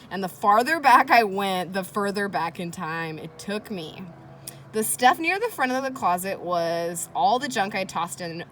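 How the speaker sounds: put-on expressive read-aloud for children; reading aloud to kids. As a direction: read it like a teacher hooking the class. Reads diary entry to class.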